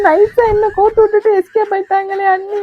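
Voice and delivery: high, loud tearful wail; wailing complaint. Acted. Left alone with mother-in-law, she wails high and loud. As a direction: lament being tricked and stuck.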